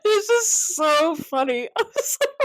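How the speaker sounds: high, slow words through laughter; cracking up. Real, not acted. Telling a friend a favourite scene, real giggling. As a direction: laugh helplessly while talking. Teller cracks up at own story.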